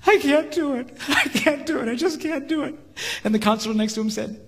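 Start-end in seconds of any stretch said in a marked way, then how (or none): 0.1-2.8 much higher, strained pleading; crying. Retold or imitated. The hitman on his knees to the counselor, high strained crying. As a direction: break down, pleading. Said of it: "he started crying".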